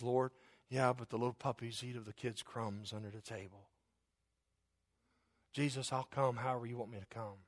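soft, submissive appeal; humble plea. Acted. Woman answering Jesus about the crumbs, soft humble plea. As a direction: ask quietly, yielding completely.

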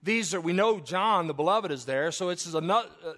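loud, high-pitched pulpit preaching; fired-up Baptist preacher; preaching at full force. Real, not acted. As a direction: drive the point home to the church.

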